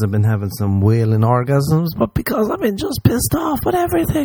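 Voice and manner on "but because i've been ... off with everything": high, whining complaint; whiny mock voice. Host moaning like a whiny Moaning Mary. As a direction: whine it in a squeaky voice.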